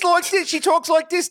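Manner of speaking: high, hammed-up Philly accent; mock Philly accent. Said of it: "she does a Philly accent".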